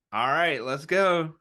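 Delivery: ordinary talk, nothing marked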